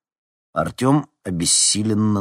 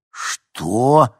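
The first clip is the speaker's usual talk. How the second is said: raised pitch, rising disbelief; stunned gasped question; 6 semitones higher, 6 dB louder than usual. A narrator's reading. Nikita learning he must take part, stunned. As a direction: blurt it out, aghast. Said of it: "выдохнул Никита".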